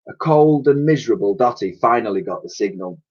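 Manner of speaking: ordinary delivery, nothing marked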